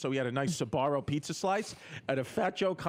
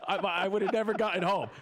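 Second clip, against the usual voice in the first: laughing hard; 6 semitones higher than usual. Real, not acted. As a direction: crack up while getting the words out. Laughing at own story.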